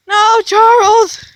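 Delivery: loud drawn-out call after Charles. Real, not acted. Girl calling after her dog Charles. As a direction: call him back, stretched and loud.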